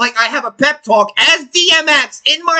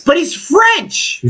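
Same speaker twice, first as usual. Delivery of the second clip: mock outrage; 7 semitones higher, much slower than usual. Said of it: "mock outrage".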